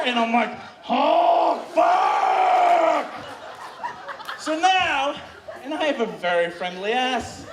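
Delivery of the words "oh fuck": loud, long-held shout; hammed-up overwhelmed roar; mock ecstatic yell. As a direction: bellow both words and stretch them. Acting overwhelmed reply.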